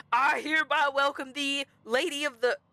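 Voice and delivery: pompous herald's proclamation. Acted. Guard ordered to welcome the guest, loud and pompous. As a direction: proclaim it with ceremony. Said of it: "Louder and with more pomp".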